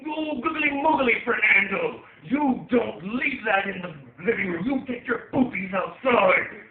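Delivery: high, drawn-out comic bellow; yelling. Acted. Owner yelling at his dog about the mess, comic yell. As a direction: scold at full volume, stretching the words. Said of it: "I'm yelling at him".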